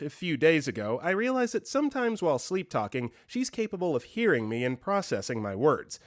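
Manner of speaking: usual speaking voice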